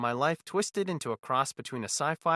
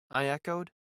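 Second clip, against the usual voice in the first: usual speaking voice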